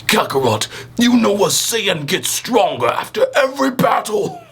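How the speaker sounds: high, strained Vegeta impression; a hammed-up anime rival. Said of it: "I can't do the voice".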